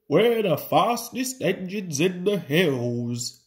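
put-on little-engine voice; chiming in. A narrator's reading. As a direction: chime in as a cheeky young engine. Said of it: "chimed Scarlurry".

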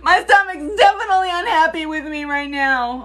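high laughing groan. Real, not acted. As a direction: laugh about her queasy stomach.

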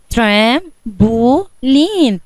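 "ترامبولين" in three slow, separate beats: tram, po, leen; split into syllables. Shown as a demonstration. Pupil answers teacher, "ترامبولين" divided syllable by syllable. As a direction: pause after each syllable of "ترامبولين". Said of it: "قسمتها على ثلاث مقاطع".

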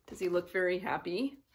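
ordinary delivery

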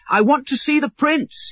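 high eager cry; a boy crying out at the gate; cried out. A narrator's reading. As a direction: call out to see the prince. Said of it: "he cried".

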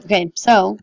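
ordinary talk, nothing marked

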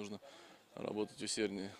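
ordinary talk

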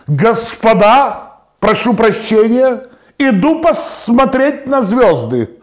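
high, slow, drawn-out proclamation; grand announcement. Acted. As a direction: call out pompously to the whole hall. Officer announces it to the ballroom.

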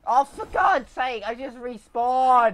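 loud, slow outraged complaint; exasperated yell. Real, not acted. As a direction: rant at the unfairness. Hit right after respawn.

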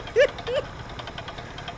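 high-pitched burst of laughter. Real, not acted. Player after running from the enemy, high laugh.

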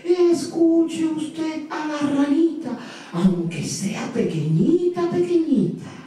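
voz aguda y lenta de tortuga; tortuga sabia. A narrator's reading. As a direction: aconseja despacio y con calma. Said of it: "y le dijo". (doña Tortuga aconseja al elefante, voz aguda y pausada)

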